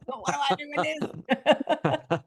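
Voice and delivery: high, slow, laughing through a mock-clueless question; laughing mock confusion. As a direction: play the baffled rookie while cracking up. Mimicking a confused newbie, laughing.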